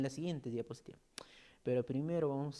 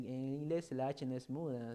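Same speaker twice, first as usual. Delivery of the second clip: ordinary talk, nothing marked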